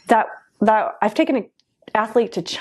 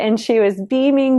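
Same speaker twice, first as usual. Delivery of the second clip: ordinary talk, nothing marked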